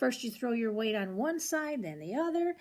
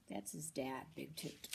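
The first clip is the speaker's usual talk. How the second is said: quiet aside; 6 semitones lower, 13 dB quieter than usual. Real, not acted. Reader quietly pointing out the dad to a child. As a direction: drop the voice for a quick aside.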